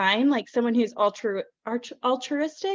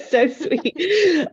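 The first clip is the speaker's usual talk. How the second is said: giggling through the words, a bit higher; laughing, touched; 7 semitones higher, 6 dB louder than usual. Real, not acted. Host laughs, touched by the compliment, laughing. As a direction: laugh it off, moved and flattered.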